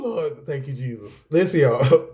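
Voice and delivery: normal talking voice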